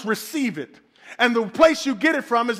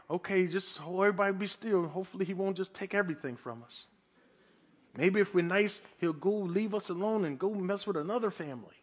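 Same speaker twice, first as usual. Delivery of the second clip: mock timid resignation; 4 semitones lower, 11 dB quieter than usual. Mocking passive believers under attack.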